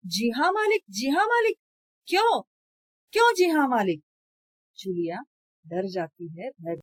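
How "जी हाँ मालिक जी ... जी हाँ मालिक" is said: higher and louder, mocking her words in a sputter; stammering with anger. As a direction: sputter her phrase back, furious. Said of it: "गुस्से से तुतलाने लगता है". (गुस्से से तुतलाते हुए)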